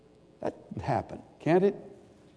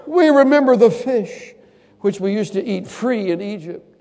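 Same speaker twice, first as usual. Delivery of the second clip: high whining grumble; whiny nostalgic voice; 9 semitones higher, 7 dB louder than usual. Acted. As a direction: whine about the fish.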